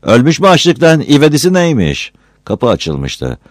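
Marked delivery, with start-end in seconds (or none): 0.0-2.1 much higher, louder woman's voice; irritated woman, pitched high; annoyed woman. Acted. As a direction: snap back at the shouting man. Woman scolds at the door.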